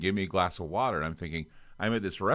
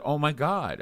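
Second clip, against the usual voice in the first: surprised; 8 semitones higher, slower than usual. Retold or imitated. Shock at Bush arriving.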